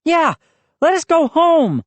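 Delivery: high, loud demand; insistent. A narrator's reading. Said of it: "Moose insisted".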